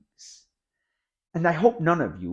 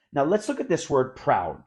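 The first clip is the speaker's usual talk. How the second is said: normal talking voice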